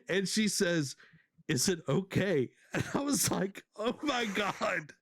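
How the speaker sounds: high voice welling up, laughing through it; barely holding back tears; overcome, pitch rising high as you push on; real, near tears. Real, not acted. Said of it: "I'm about to cry".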